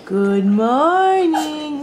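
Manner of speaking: very slow, stretched good morning; drawn-out good morning. Shown as a demonstration. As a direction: model "Good morning." slowly for a baby. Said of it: "Can you say good morning to Mama?".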